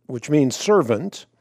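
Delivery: normal talking voice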